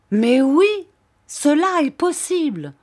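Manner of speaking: loud and high insistent declaration; proclaimed. Acted. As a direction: proclaim it, sure of yourself. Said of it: "clama Sir Hassel".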